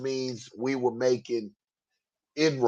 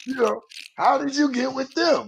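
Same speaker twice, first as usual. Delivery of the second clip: excited; 12 semitones higher than usual. Retold or imitated. Retelling their reply, animated.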